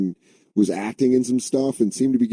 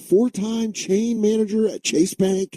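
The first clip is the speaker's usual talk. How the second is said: much higher put-on daughter voice; mock bragging daughter; 11 semitones higher than usual. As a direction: boast to Dad in a high mimicking voice. Acted daughter bragging to her dad.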